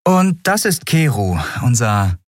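lightly voiced captain, high; young captain. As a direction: break it to him gently. (Kapitänin, sachlich)

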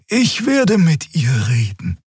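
calming Xia. A narrator's reading. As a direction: ease him down slowly. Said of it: "sagte die Draxül".

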